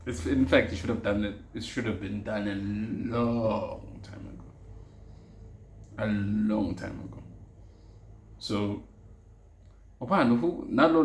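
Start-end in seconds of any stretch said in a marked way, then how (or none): 3.2-7.2 "long" stretched out slowly; drawn-out emphasis. Real, not acted. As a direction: draw out the word "long" for emphasis. Emphasising how overdue it was.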